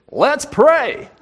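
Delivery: high, drawled Southern "Let's pray". Retold or imitated. Friend imitating Jack's Southern voice at small group, laughing. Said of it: "he used a, a Southern voice".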